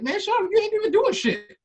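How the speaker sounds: high, loud mocking scold. Scolding the smallest dude at the gym, mock loud. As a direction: snap at him in a put-on voice. Imitating angry teammate.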